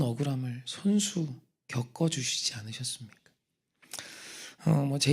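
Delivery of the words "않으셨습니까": soft whispered ending; hushed rhetorical question. Real, not acted. Preacher ends question in whisper.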